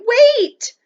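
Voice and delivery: high, loud sudden cry. A narrator's reading. Omri crying out to the Indian, loud and high. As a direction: Omri calling out to stop him. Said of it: "He cried".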